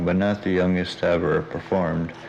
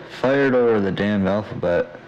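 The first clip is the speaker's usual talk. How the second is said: ordinary delivery, nothing marked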